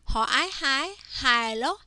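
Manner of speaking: teacher's sing-song spell-and-read drill. Shown as a demonstration. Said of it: "read each word by first saying the consonant".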